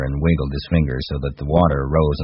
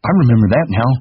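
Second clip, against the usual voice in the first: put-on high, eager childlike voice; Lenny thrilled he finally remembers; delighted; 8 semitones higher, 4 dB louder than usual. A narrator's reading. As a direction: blurt it out with relief and joy.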